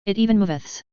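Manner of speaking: "moveths" with a th in place of the s; tongue-damaged lisp on "moveths". A narrator's reading. Jaraz showing off his ragged tongue, lisping "moveths". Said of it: "Jaraz lisped".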